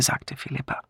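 usual speaking voice

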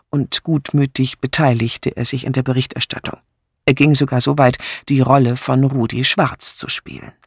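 ordinary delivery

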